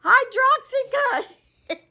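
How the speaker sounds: very high, drawn-out word through laughter; reenacted giggle fit, stretching the word; laughing helplessly. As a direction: retell it as a laughing squeal. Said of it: "I just start dying laughing".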